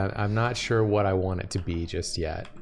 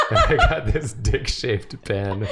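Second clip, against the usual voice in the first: laughing; 8 semitones higher, 6 dB louder than usual. Acted. Laughing while voicing detective.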